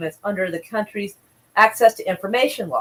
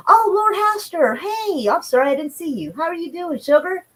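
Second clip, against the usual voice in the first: sugary southern hostess; 8 semitones higher than usual. Pretend. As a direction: greet the viewer like a doting hostess, pitched high. Sugary greeting to a viewer.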